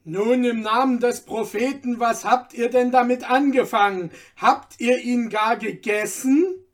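heated cross-examination; exasperated interrogation. A narrator's reading. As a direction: demand answers at the end of your patience.

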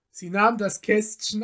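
ordinary delivery

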